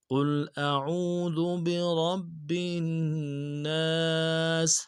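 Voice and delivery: slow drawn-out tajweed chant; Quran recitation. Shown as a demonstration. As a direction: recite each verse slowly and melodically. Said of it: "اب لفظ قل کے اندر جو قوف ہے". (آہستہ سریلی تلاوت)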